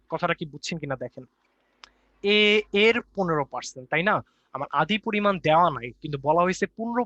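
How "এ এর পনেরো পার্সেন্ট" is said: higher, louder and slow, leaning on "এ এর"; emphatic repetition. Shown as a demonstration. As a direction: punch "এ এর" high and loud, spacing it out. Teacher repeats key point for clarity.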